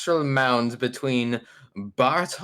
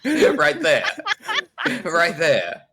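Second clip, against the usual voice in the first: slow, laughter breaking into the words; laughing; 3 semitones higher, 4 dB louder, slower than usual. Real, not acted. Player pointing out the astral mound, slowly, through laughter. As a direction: push each phrase out through giggles.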